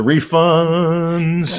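very slow, high announcer call; drawn-out announcer voice. Acted. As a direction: stretch it out like a game show host.

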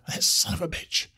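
whispered curse under the breath; muttered curse. Acted. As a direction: hiss it quietly to yourself. Cursing the bear under breath.

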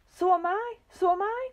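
high, loud wail of alarm; cried out. A narrator's reading. Mister Twit agreeing he feels giddy, crying out. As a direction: cry out in rising panic. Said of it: "cried Mister Twit".

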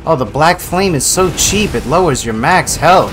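loud high exasperated complaint; frustrated rant. Real, not acted. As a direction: whine loudly at the unfair game.

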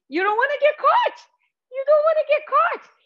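very high, squeaky playful alarm; playful warning. Pretend. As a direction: squeal a mock warning to little turkeys. Playing turkeys hiding from people.

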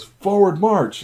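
high, slow stretched drill command; drawn-out march command. Retold or imitated. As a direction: call the march like a parade sergeant. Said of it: "he goes".

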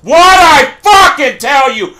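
seething bark at the game; angry shout. Real, not acted. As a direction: hammer every word in rage. Angry at predicted death.

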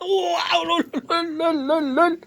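high laughing lol lol. Real, not acted. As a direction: giggle the lols out high. Laughing at the cat.

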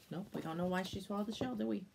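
ordinary delivery

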